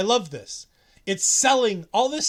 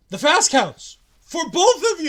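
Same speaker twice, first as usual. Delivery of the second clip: Ali boasting, higher and louder; proud referee Ali; 8 semitones higher, 8 dB louder than usual. Retold or imitated. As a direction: announce it proudly, pitched up. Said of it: "they're like".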